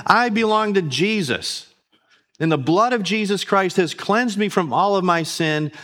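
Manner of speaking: bold declaration to the enemy. Pretend. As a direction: stand firm and tell the devil to go. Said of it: "You know what you can say?".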